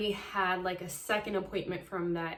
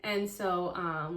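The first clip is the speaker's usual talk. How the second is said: ordinary delivery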